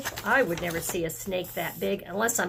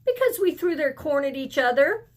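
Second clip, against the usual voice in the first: put-on excited child; 5 semitones higher than usual. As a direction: spill it out like an excited kid. Reading the dialogue again.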